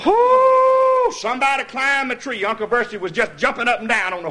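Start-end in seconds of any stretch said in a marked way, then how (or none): 0.1-1.1 very high, loud whoo with a laugh; excited whoop. Retold or imitated. Hunter spotting the coon up the tree, high laughing whoop. Excited cry in the retold hunt.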